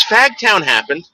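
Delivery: light impression of a dazed singer. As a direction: repeat it like a burnt-out guy, slowly. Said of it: "He goes".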